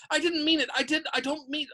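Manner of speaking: high, flustered backtracking; frantic. A narrator's reading. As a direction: protest anxiously in a high voice. Hermione frantically backtracks to Peeves.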